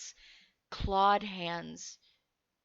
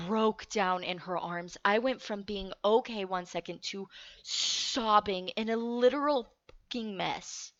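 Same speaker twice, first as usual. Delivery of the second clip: ordinary talk, nothing marked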